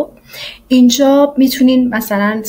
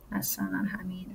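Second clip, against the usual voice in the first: whispered aside to herself; 2 semitones lower, 18 dB quieter than usual. Real, not acted. Naming the saved file, a soft whispered aside. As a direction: breathe the words out under her breath.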